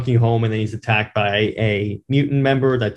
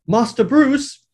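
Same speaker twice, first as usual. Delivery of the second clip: much higher, polite butler voice; a fussy butler, pitched way up; Alfred; 11 semitones higher, slower than usual. Retold or imitated. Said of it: "I'll be, uh, Alfred this part".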